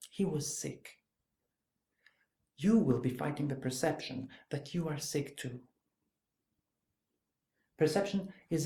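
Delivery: measured monologue with long pauses; dry, clear journalist. Acted. Journalist trying to win over a killer's daughter, dry and clear. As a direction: speak straight at the listener, letting each point land. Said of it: "telling things very dryly and very clearly".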